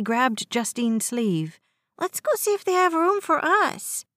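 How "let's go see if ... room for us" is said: put-on much higher, urging child's voice; eager little boy. A narrator's reading. West urges his mother to check for seats, high childish urging. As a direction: press Mama as a small boy.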